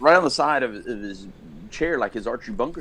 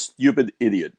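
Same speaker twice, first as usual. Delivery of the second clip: imitated dad, louder; dad's put-down; 6 dB louder than usual. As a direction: quote dad's insult with more punch, lightly voiced. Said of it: "But he says".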